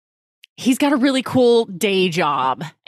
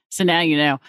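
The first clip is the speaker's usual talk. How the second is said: ordinary talk, nothing marked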